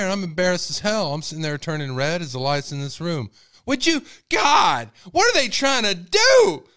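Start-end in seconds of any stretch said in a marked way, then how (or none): 3.7-6.7 very high, loud, slow exasperated shouting; furious dad shout. Retold or imitated. Father shouting in the store, very loud and high. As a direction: shout at the heavens about the price.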